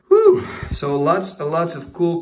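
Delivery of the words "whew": whew of relief. Real, not acted. Relief after long mail video.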